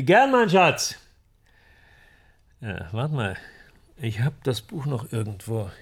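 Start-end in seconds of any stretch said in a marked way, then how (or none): 0.0-1.0 raised, high and loud reply; calling out. Acted. Man answering his darling's coffee offer, calling out. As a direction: call it across to someone out of the room. Answers partner from another room.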